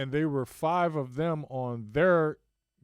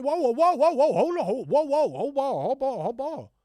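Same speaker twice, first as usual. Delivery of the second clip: mock yell; 11 semitones higher, 7 dB louder than usual. Player shouting hold ball on court, loud. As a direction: yell to stop the play. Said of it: "be like".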